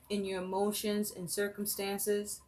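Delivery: normal talking voice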